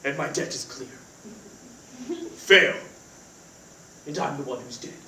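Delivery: low hushed brooding killer voice; assassin's voice. Acted. [name] tells roommate Cody about the call in his assassin voice, low and hushed. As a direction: mutter it like a grim movie assassin. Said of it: "it's my assassin's voice".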